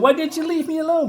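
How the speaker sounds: high, fast plaintive question; upset woman. Acted. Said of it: "She said".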